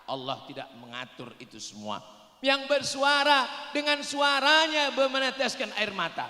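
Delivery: normal talking voice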